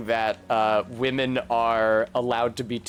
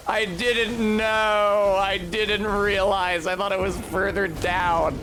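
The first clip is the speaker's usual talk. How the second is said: high, slow, drawn-out whine; wailing lament; 6 semitones higher, slower than usual. Real, not acted. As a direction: bemoan your blunder in a long wail. Laments the mistaken jump.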